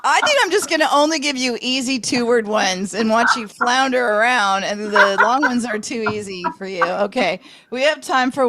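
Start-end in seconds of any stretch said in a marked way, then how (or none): none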